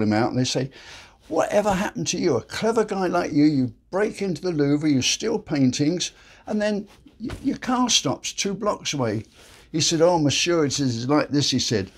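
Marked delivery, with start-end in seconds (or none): 1.3-9.2 high-pitched mock incredulity; incredulous questioning. Police to the caught Louvre robber, incredulous. 10.0-11.5 put-on comic French accent. Caught robber explains to the police, French accent. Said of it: "Sorry for the French accent".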